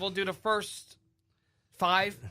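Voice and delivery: ordinary talk, nothing marked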